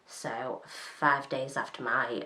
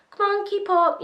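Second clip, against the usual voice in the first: high, louder imitation of an impatient call; mock calling out; 6 dB louder than usual. Teller mimics friend urging her on from the car window, calling high. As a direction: call ahead to a straggler, pitched way up.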